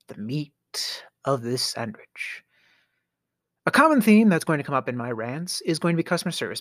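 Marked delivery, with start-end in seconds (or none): 0.1-2.4 slow conspiratorial whisper; hushed, drawn-out aside. Real, not acted. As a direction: lean in and whisper it. Dramatic aside to listeners.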